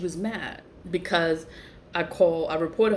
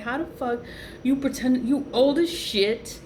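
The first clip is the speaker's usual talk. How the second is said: higher, mocking disbelief; incredulous mockery; 6 semitones higher than usual. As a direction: scoff at her. Retold mocking retort.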